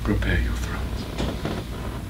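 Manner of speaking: low, hushed vow of revenge; quiet menace. Acted. Avenger readies the killing, low and hushed. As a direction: drop right down after the outburst.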